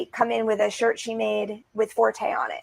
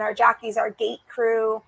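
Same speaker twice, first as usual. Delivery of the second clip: ordinary delivery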